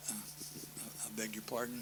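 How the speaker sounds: softly whispered, half-voiced apology; hushed apology. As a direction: slip past people with a quiet whisper. Woman pushing through the crowd.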